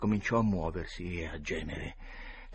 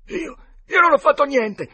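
much higher and louder, stammering in panic; panicked denial; 13 dB louder than usual. A narrator's reading. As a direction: deny it desperately. Potter accused of murder. (Potter, accusato, nega disperato ad alta voce)

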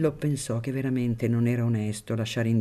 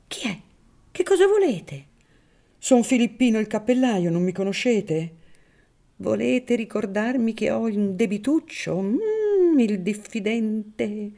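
higher, suspicious priest voice; a wary old priest keeping his distance; Don Cirillo, wary priest; 10 semitones higher, 5 dB louder than usual. Acted. Don Cirillo suspiciously answers the hatter, in a higher voice.

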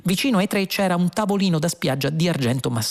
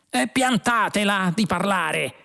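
complaining; 6 semitones higher than usual. A narrator's reading. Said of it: "si lamentava Zaphod".

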